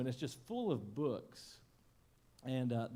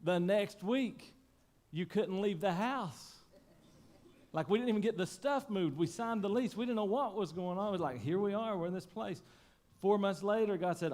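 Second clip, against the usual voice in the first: building sermon opener; preaching; 8 semitones higher, 6 dB louder than usual. Acted. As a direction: preach it out to the congregation. Opening of the sermon.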